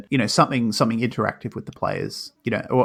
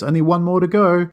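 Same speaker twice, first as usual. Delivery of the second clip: put-on higher, louder excited kid quote; lightly mock an eager boy, pitched up and loud; 8 semitones higher, 6 dB louder than usual. Retelling Bobby's eager line.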